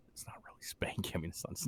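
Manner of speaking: unvoiced aside whisper. Real, not acted. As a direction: whisper it like a secret.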